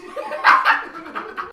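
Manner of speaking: shrill breathy cackle; manic high laugh. Acted. Manic cackle after bit.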